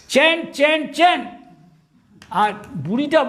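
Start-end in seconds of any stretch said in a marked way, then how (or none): 0.1-1.2 put-on a high, louder old woman's voice repeating it; mock old wife calling to her husband; old wife nagging. Old wife in the park scolding her husband, high and insistent. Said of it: "আর বুড়িটা বলছে বুড়োকে".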